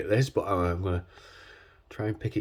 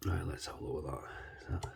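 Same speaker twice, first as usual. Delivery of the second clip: whispering; 5 semitones lower, 15 dB quieter, faster than usual. Real, not acted. Quiet focus while picking lock.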